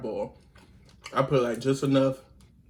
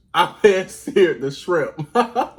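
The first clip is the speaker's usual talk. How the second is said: laughing; 5 semitones higher, 8 dB louder, much slower than usual. Real, not acted. Laughs at her own slip.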